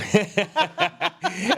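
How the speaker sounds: high, bursting laugh at the drink joke; burst of laughter. Real, not acted. Guest laughing at the host's joke about a nightly drink, high bursting laugh. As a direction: laugh out loud, caught off guard.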